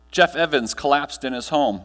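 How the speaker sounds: normal talking voice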